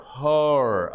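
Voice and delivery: normal talking voice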